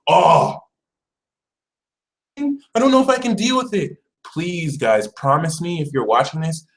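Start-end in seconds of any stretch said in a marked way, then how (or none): 0.1-4.0 high, hand-wringing mock doubt; higher mock fretting voice; mock worried whine. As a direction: imitate someone too scared of good luck. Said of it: "You can't really just be like".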